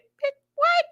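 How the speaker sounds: high squeal of disbelief; shocked disbelief. Real, not acted. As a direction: react like you can't believe it. Reacting to absurd butterfly death.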